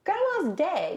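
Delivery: high flat afterthought; blunt, offhand news. Retold or imitated. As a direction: toss it off as an afterthought. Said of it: "Didn't lead with it".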